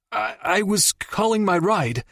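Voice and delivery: high, stuttering panicked excuse; stammering fear. Acted. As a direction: trip over the first word in fear. Captive stammers under interrogation.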